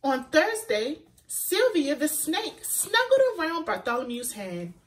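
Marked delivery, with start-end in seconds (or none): none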